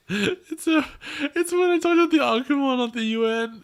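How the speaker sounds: high, gushing over cute art; delighted about the fan drawing. Real, not acted. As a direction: squeal a little over how cute it is.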